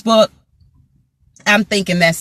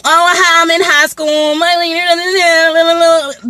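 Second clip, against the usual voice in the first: higher, dragged-out mock whine; 6 semitones higher, slower than usual. Scolding the girl's excuses, mimicking her in a whiny drawl. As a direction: parrot the excuse sarcastically.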